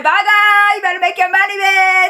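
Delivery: high, loud tipsy farewell; drunk loud goodbye. Retold or imitated. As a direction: yell it across the bar, drunk and cheeky. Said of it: "being all loud".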